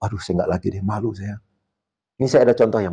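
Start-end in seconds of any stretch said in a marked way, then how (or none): 0.0-1.4 softer, sheepish apology; ashamed. Retold or imitated. Student apologising to coach, softer ashamed apology. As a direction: mumble it in shame. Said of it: "Dia akan malu nih".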